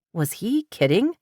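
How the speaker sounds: usual speaking voice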